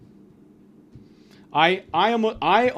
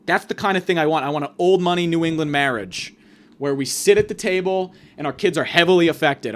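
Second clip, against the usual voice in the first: ordinary talk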